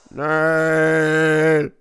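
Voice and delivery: loud drawn-out cry; dramatic no. Acted. Lord cries out no to his bored servant. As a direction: shout it like a melodrama.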